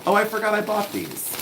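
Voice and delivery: sudden happy rediscovery; oh, a pleasant surprise, said high; surprised. Real, not acted. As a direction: pitch jumps up high in delight at a forgotten find. Surprised find, then repeat.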